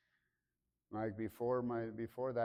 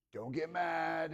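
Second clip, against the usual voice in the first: higher, slow, sheepish plea; sheepish little brother; 8 semitones higher, 4 dB louder, much slower than usual. Retold or imitated. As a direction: ease the news in like a guilty kid brother. Brother confessing on the phone.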